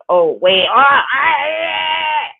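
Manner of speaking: normal talking voice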